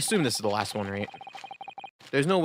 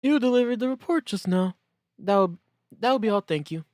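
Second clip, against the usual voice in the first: put-on much higher fussy old judge; 9 semitones higher, 3 dB louder than usual. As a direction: dismiss the officer primly from the bench. Judge dismisses officer.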